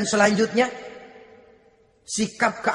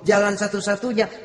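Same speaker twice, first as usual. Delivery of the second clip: ordinary talk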